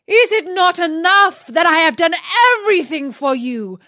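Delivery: loud, high, furious rant; angry genie. Acted. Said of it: "he cried".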